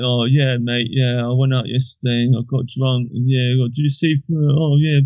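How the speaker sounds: mocking impression of a coarse bloke rambling about drinking; put-on loutish chatter; unpleasant-voiced drunken mate. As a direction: ramble like a lout, mumbling into half-finished phrases. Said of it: "they talk like this".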